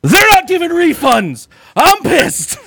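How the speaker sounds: high, slowed mock outrage; angry guy. Angry customer at box office complains no refunds, high and slow. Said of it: "And he goes".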